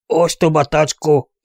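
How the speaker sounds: mildly shouted insult, drawn out. A narrator's reading. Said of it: "kiáltotta Wyoming".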